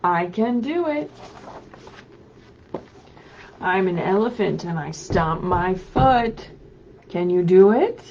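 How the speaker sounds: loud, lively picture-book read-aloud; animated storybook voice calling out to children. Acted. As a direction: read it big for little kids at home. Reads picture book aloud to kids.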